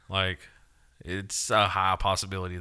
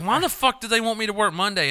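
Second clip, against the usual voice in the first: much higher, incredulous complaint; retold indignation; 11 semitones higher, 4 dB louder than usual. Retelling finding out about working Monday, high-pitched indignation. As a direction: complain as if outraged by the schedule. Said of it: "I was like".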